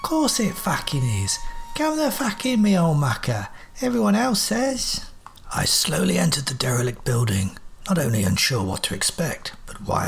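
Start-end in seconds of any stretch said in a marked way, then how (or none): 0.0-5.0 high, cheeky cockney robot voice, climbing very high; a scrappy machine mocking the famous name.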